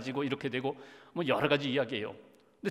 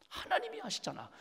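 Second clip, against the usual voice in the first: 하나님이 lifted very high, then 아시잖아 falls away softly; high soft reassurance; 7 dB quieter than usual. Acted. Pastor softly assuring listeners that God knows. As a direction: float it up gently like a comfort. Repeated refrain in sermon.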